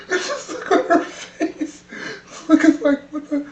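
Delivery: high-pitched, helpless real laughter through the words; can't stop laughing. Real, not acted. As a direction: squeeze the words out while cracking up. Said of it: "can't do a try not to laugh challenge".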